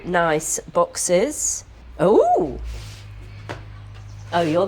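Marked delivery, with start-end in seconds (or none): 2.0-2.6 high, loud delighted ooh; excited ooh. Real, not acted. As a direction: squeal at the sight of them. Delighted at unboxing.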